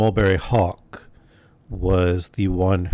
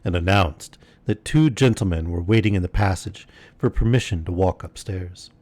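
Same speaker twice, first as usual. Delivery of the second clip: ordinary delivery, nothing marked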